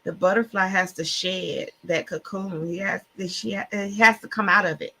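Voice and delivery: usual speaking voice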